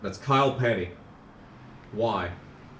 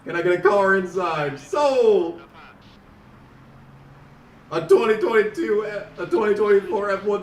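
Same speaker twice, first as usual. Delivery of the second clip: auctioneer; 4 semitones higher than usual. Retold or imitated. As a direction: slam down the sale. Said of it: "he sounds like a fucking auctioneer".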